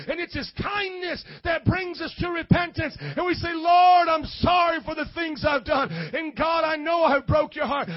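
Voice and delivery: loud, high, impassioned sermon cry; full-force preaching. Real, not acted. Preacher cries out repentance to the Lord, full-force preaching.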